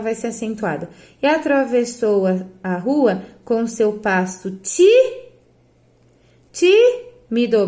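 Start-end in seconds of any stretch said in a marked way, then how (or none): 4.6-7.7 "tímido" with TÍ alone and punched, then a slow restart; stress on first syllable. Shown as a demonstration. Teacher reading the lyric, hammering TÍ in tímido. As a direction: hit ti-, pause, then TÍ-mi-do slowly. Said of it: "uma fortona e sobram duas fracas".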